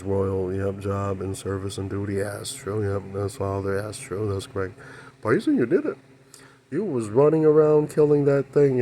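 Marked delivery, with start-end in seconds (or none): 5.2-8.8 high, loud praise for Pi; excited cheer. Real, not acted. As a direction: cheer him on like a fan.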